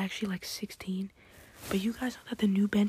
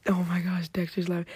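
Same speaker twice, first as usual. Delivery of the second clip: normal talking voice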